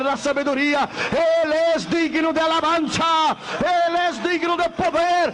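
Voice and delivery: loud, high, slow praise shout; preacher crying out praise to God at full force; full-force preaching. Acted. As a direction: lift the church in praise.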